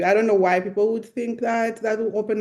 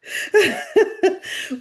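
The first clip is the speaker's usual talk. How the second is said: laugh; 12 semitones higher than usual. Real, not acted. Laughs after quoting herself.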